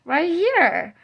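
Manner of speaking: put-on high, loud child's answer; retold child's reply, pitched well up; imitated little girl. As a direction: pipe up like a toddler pointing it out. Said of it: "And she goes".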